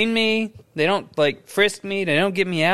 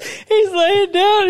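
ordinary delivery

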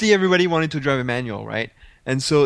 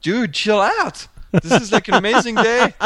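high-pitched, incredulous mock retort; retold exasperated plea; 8 semitones higher than usual. Telling a complaining journalist to relax, exasperated. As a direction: plead with a complainer, pitch jumping up.

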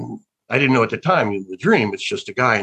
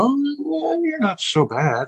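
high, slow grudging praise; older actor reassuring himself about old work, high and slow; mock self-approval; slower than usual. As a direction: pat yourself on the back, hammed-up. Retold self-assessment, put-on voice.

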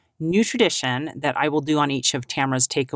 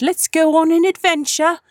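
mock English accent, much higher and louder; hammed-up English voice, pitched way up; funny English accent; 12 semitones higher, 6 dB louder than usual. Mother joking with her daughter on their trip, in a funny English accent. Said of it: "I put on a funny English accent".

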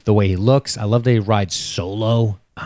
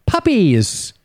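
high-pitched little cheer; exclaimed delight; 9 semitones higher than usual. Acted. Co-host reading out an imagined wheel placard, exclaiming happily. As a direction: cry it out like an exclamation mark. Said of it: "exclamation point".

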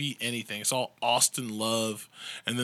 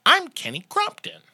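much higher, louder anchor intro of "I'm Kenny Crumpton"; newscaster demo; 11 semitones higher, 4 dB louder than usual. Shown as a demonstration. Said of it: "Say it like this".

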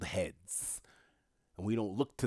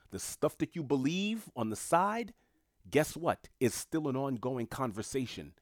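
raised challenging voice; 6 semitones higher, 5 dB louder than usual. Real, not acted. Heated challenge to teachers.

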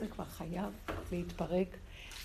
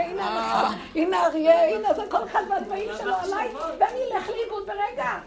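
high, loud, laughing delivery; amused retelling of the rabbi; laughing mock lament; 10 semitones higher, 10 dB louder than usual. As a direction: laugh as you list the dangers. Mocking voice of the rabbi.

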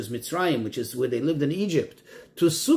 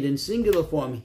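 ordinary talk

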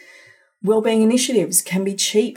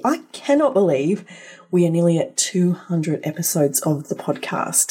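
normal talking voice

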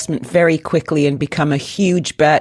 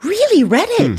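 surprised; 13 semitones higher than usual. Real, not acted. Surprised Reddit is a unicorn.

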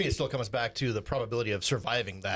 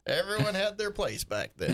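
put-on old man voice; 9 semitones higher than usual. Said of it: "do your old man voice".

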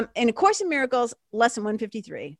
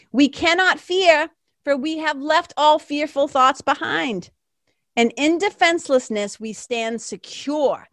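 higher, louder, slow and lifted; uplifted reading; 6 semitones higher, 5 dB louder than usual. Real, not acted. Reading the lesson aloud, uplifted and slow. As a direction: rise with the words.

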